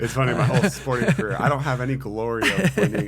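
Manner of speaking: laughing hard through the words, high and loud; real laughter. Real, not acted. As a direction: crack up at your own losing record. Laughing at own losing record.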